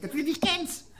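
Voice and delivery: very high, far-off tinny voice. Shown as a demonstration. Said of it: "so ganz hoch und Metallen sprechen".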